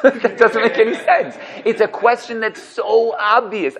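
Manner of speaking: laughing through the words; real laughter at an obvious question. Real, not acted. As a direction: chuckle as you say it, amused.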